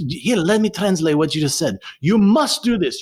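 high-pitched mock teacher translating; French teacher's voice. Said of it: "and he goes".

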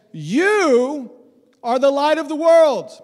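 loud declared line to the crowd; ringing proclamation. Acted. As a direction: announce it like a prophet.

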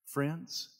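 usual speaking voice

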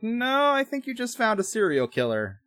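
high, stretched-out disbelieving no; high-voiced mock objection; mock correction. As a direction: pitch it way up, playfully contradicting. Teller's mock reply to the commander.

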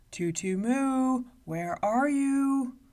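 loud, slow booming giant voice; a huge giant calling out, slow. A narrator's reading. Giant at the door calling for the girl, booming. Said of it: "Then he called".

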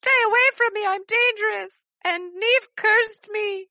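high, pleading character voice; werewolf girl pleading. Acted. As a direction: beg them to stay away. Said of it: "she's like".

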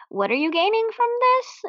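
high, climbing incredulous question; mock protest, pitch soaring towards the end; baffled objection. Viewer challenges the belly dancing character, incredulous.

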